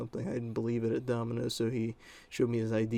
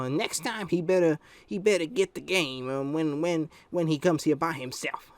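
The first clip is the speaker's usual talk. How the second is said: higher mock-demanding mother; imitated mom; 5 semitones higher, 4 dB louder than usual. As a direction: mimic a mom laying down a warning. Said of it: "was all".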